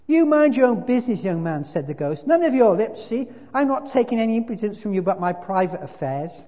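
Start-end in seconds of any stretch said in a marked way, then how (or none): 0.1-1.6 loud, high telling-off; angry rebuke. A narrator's reading. Ghost rebuking the young man, loud and angry. Ghost rebuffs the spirit's accusation. 2.3-6.4 loud, high telling-off; indignant big ghost, rebuking. A narrator's reading. Ghost guarding his private affairs, angry rebuke.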